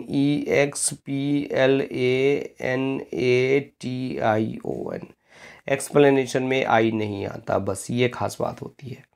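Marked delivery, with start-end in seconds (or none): 0.1-5.1 slow spelling out. Shown as a demonstration. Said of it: "जब explanation की बात".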